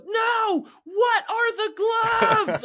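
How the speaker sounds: very high, loud mock tantrum; slow, high-pitched kid meltdown, imitated; imitated child's scream. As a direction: stretch it into a child's shrieked demand. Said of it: "she's screaming".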